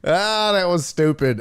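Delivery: ordinary delivery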